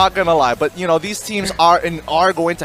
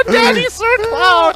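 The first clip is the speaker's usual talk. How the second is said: laughing; slower than usual. Real, not acted. Teasing nickname, laughing.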